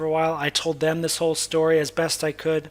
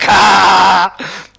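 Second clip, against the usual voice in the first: one very loud "Ha!"; imitated answering shout; 3 semitones higher, 13 dB louder than usual. Storyteller imitating the young man's answer, explosive loud shout. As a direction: blast out a single sudden shouted laugh.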